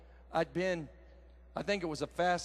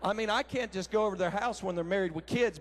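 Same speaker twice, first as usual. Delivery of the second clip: ordinary talk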